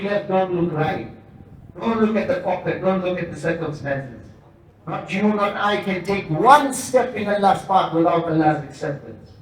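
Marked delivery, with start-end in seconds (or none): none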